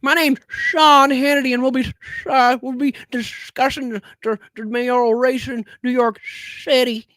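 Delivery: mock Sean Hannity. Streamer parodying Hannity's show opening, pompous mock anchor. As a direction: ham up a self-important TV host.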